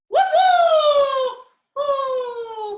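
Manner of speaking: high, loud cheering whoop; excited whoop. Real, not acted. Teacher cheering kids through dog poses, loud whoop.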